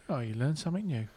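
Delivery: lightly put-on southern English accent; a touch of chirpy southern English. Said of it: "Is that your sou-, southern voice?".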